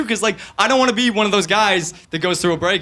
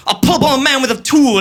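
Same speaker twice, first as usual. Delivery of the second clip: mock British rapper; 9 semitones higher, 3 dB louder than usual. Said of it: "He'll be like".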